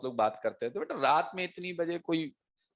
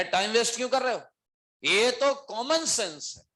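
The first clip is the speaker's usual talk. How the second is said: much higher, scolding them in a mock rage; angry boss; 8 semitones higher than usual. Boss scolding the two employees for their idea, in a mock rage. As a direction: tell them off for wasting time.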